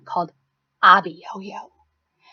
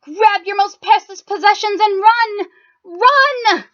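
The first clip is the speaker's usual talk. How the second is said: yelling; 9 semitones higher than usual. Acted. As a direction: shout for everyone to flee. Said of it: "Men yelled".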